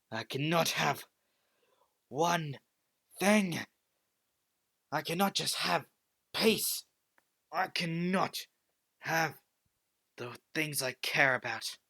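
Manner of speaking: anguished outburst. Acted. Hero breaks down in anguish.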